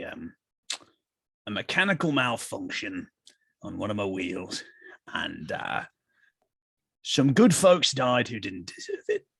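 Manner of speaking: a hint of a weary carriage driver; low carriage driver. [name] introduces his character Roland.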